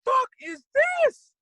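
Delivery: very high, loud, slow astonished yell; amazed shout. Retold or imitated. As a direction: blown away, shout it out. Stunned by the band.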